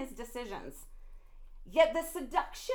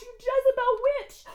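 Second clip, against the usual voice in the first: higher, louder, crying out; calling out; 9 semitones higher, 7 dB louder than usual. Real, not acted. Preacher to the Jezebel woman, crying out in anger. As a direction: hurl the insult.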